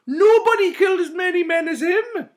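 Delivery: high, loud fawning shout; creepy knight. A narrator's reading. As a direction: gush like a creepy sycophant. Creepy knight praising Mowbray.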